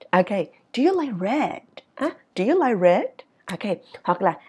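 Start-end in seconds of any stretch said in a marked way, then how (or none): 0.7-3.0 "red" lifted at the end; rising question tune. Shown as a demonstration. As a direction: ask with "red" going up. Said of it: "ngữ điệu ở cuối câu nó đi lên". ("red" lên giọng cuối câu)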